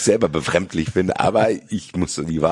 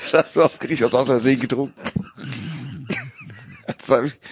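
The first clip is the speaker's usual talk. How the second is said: clickbait video narrator; 6 semitones higher, faster than usual. Pretend. Continues the YouTuber parody.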